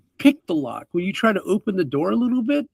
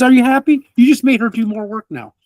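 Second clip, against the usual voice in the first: man berates the party for making her work; scolding rant. Acted. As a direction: shame them like a stern parent. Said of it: "He goes".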